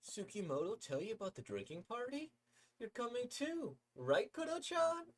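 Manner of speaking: put-on chirpy girl inviting a friend; high sweet girl asking eagerly; cheerful girl voice.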